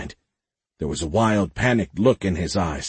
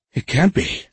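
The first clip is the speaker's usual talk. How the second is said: gasped; 7 semitones higher than usual. A narrator's reading. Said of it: "someone gasped".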